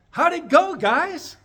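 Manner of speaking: high, loud chipper call; sit up and chirp it out brightly; cheerful call. Retold or imitated. Waking cheerfully mid-surgery.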